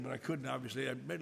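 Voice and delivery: ordinary talk, nothing marked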